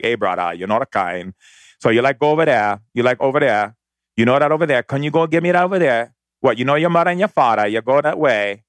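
Hawaiian pidgin. Retold or imitated. Hawaiian pidgin talk recalled from childhood at home. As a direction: slip into the Hawaiian pidgin he grew up speaking. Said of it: "like this kind of pidgin Hawaiian kind of talk".

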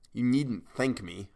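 slow, deliberate sharpness; said sharply. A narrator's reading. Man rebuffs the woman's grateful kisses, mildly sharp. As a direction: rebuff with a touch of edge. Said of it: "I said sharply".

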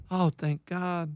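slow, soft sigh of relief, imitated; the coach's quiet, drawn-out relief; Keith. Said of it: "And he goes".

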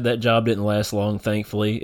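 ordinary talk

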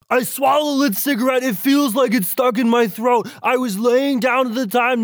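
high, strained all caps voice; all caps panic voice. Acted. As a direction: shout every line in all caps. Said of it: "this is my all caps, "I just swallowed a cigarette" voice".

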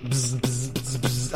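soft buzzing joy buzzer noise; electric buzz. Acted.

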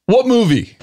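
high-pitched, abrupt demand; bodybuilder's challenge. Acted. Bodybuilder turning on him in the checkout line, abrupt high-pitched challenge. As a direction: imitate a stranger firing a question.

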